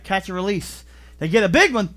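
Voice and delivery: ordinary delivery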